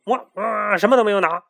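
high, loud frightened wail; panicked denial. A narrator's reading. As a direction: cry out in panic. Scared chick denies. (惊慌地否认)

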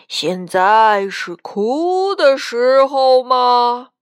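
loud, slow, booming ghostly rebuke; imposing voice from the well; stern ghost. A narrator's reading. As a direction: draw it out loudly like a scolding spirit. Ghostly uncle rebukes weeping grandpa.

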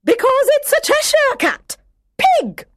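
high, loud shrill bark of a duchess; shouting Duchess. A narrator's reading. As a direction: snap it out like a cross duchess. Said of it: "the Duchess was shouting".

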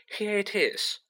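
light up as you find it; excited. A narrator's reading. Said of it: "said Hermione excitedly".

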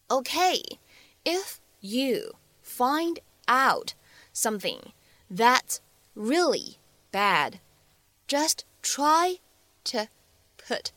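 very slow, separated teaching read; word by word. Shown as a demonstration. Teacher breaks the line down for students, word by word. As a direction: read it out slowly, a pause between the words.

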